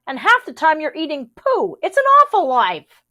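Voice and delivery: high, loud grumbling rabbit. A narrator's reading. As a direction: whine it like a fed-up rabbit. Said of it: "said Rabbit gloomily".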